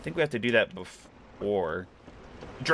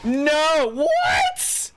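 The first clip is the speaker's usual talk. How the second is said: high, loud startled cry; shocked cry; 5 dB louder than usual. Real, not acted. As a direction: yelp it out in shock. Caught by enemies in game.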